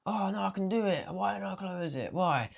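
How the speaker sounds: high, whining self-reproach; hammed-up frustrated moaning; mock whiny regret.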